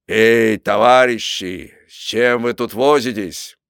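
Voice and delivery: loud, drawn-out hail to shipmates; calling out. A narrator's reading. Cook hails the busy sailors, calling out loudly. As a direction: call out slowly across the deck. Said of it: "вскричал".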